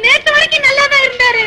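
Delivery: high put-on wail; hammed-up tearful cry. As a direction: insist through fake tears. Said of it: "ஏமா அழுகுற?".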